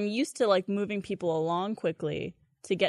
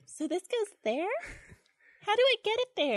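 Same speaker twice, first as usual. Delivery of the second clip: put-on confused girl; 12 semitones higher than usual. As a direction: act helplessly confused. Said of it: "looking confused".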